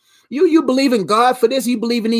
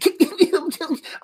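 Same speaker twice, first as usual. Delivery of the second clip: high, odd mimicked cackle; crazy laugh; 5 semitones higher than usual. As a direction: put on a crazy-sounding laugh. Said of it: "I know your laugh sound crazy".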